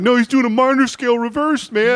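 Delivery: high-pitched, urgent insistence; high, worked-up mock protest; excited defence. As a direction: voice an eager friend explaining from the back. Voicing Matt defending the comic.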